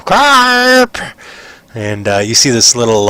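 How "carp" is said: put-on high, loud call for a child; mother's drawn, high-pitched yell. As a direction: holler the name out across the farm. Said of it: "all of a sudden you hear a yell".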